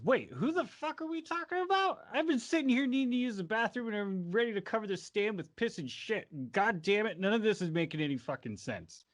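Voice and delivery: high-pitched, exasperated rant; loud outburst. Acted. Witness fed up with the confusing trial, loud exasperated outburst. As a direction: blurt it out, starting high and coming down. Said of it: "And he loudly goes".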